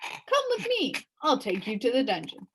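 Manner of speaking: high put-on jailer voice; a jailer leading prisoners off; gruff jailer. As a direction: order the prisoner along. Jailer figure speaks.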